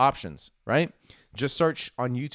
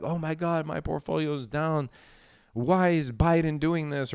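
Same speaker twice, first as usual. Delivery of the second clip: whiny, higher mock panic; mock panicked investor; 4 semitones higher than usual. Mocking chat investors who panic over red candles, whiny. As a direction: lightly play the panicking retail investor. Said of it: "people are like".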